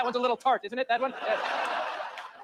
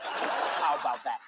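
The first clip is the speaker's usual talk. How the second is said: slow menacing repeat; 8 semitones lower, much slower than usual. Acted. Dad repeats, slow take.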